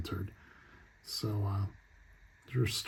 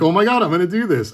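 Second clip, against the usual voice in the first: excited; 8 semitones higher, 6 dB louder, faster than usual. Retold or imitated. Collector retelling his thrill at a coupon, excited fast blurt. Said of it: "So I was like".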